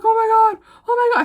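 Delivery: high, loud retold shriek of fright; panicked screaming. Seeing a snake at her foot, a high panicked scream. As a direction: scream it like a snake just hit your shoe. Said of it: "I started screaming".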